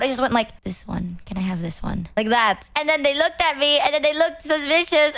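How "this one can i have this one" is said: soft mumble, much softer; very quiet. Retold or imitated. Retelling how she quietly asked the artist for merch, very soft. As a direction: say it barely above a breath. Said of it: "I didn't even talk very loud".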